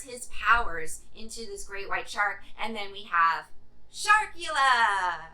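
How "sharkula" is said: drawn-out, slow announcer reveal; dramatic title reveal. Real, not acted. Reviewer unveiling the title monster to viewers, slow dramatic announcement. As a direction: unveil the monster's name like a movie trailer.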